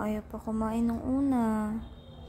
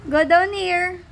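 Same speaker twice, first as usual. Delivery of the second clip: loud commands; 3 semitones higher, 8 dB louder, slower than usual. Real, not acted.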